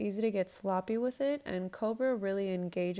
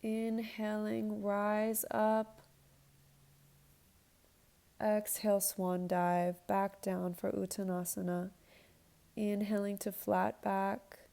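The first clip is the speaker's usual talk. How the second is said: yoga instructor cueing. Acted. Leads a yoga flow.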